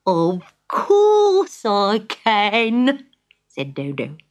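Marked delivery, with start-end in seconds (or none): 0.0-3.0 very slow. Acted. Dodo answers robber.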